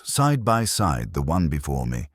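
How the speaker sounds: plain reading voice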